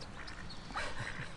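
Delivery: soft breathy chuckle; hushed wheeze after the pun; quiet laugh. Real, not acted. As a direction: laugh almost silently.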